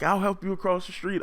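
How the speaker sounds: high, sweet mock lady voice; high little lady voice; helpful lady. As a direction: offer it in a squeaky helpful tone. Lady offering help.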